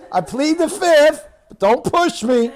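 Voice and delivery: loud, high comic warning; hammed-up defiant threat; mock threat.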